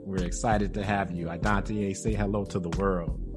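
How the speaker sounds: normal talking voice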